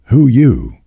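put-on a slurred, suspicious old drunk; lightly voiced drunk man, puzzled; drunk old uncle. A narrator's reading. Drunk Uncle John answers.

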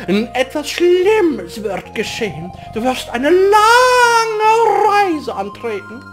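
ominous prophecy voice. Acted. Game character voiced ominous prophecy.

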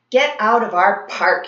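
sputtered. A narrator's reading. Bully sputtering at the kids to leave his park, angry. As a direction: spit out the words in shock. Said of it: "he finally sputtered".

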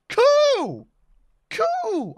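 high, bright cheer; excited. Real, not acted. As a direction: squeal it happily. Reacting to tame.

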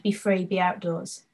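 ordinary delivery

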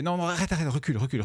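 high, rushed panic pleading; urgent pleading; panicked. Real, not acted. Panicked at clicking by mistake.

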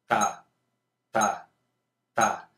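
evenly spaced single syllables, each dropping off; quarter-note syllable count. Shown as a demonstration. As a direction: count out steady beats on "ta". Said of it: "la sílaba que utilizan sería ta".

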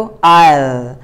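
"I'll" as one syllable ending in a dark l; teacher models the contraction "I'll"; contracted I'll. Shown as a demonstration. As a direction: clip it into a single syllable, "I'll". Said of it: "बस double L याद रखो".

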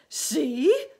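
high, loud incredulous shout; indignant earthworm yell. Acted. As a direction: shout it back as an outraged question. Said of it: "shouted the earthworm".

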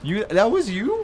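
high, loud, slow disbelief; shocked disbelief. Real, not acted. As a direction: stretch it out in disbelief. Shocked at being killed.

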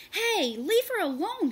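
high, loud shout at the attacker. A narrator's reading. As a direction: yell it to stop him. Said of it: "he shouted".

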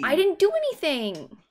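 loud, high-pitched denial; protesting. Real, not acted. Protesting a teasing accusation.

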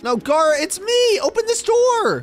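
high-pitched plea through a door; urgent calling out. Acted. Trapped kid to friend outside, urgent high call. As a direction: call high, stretching the last words.

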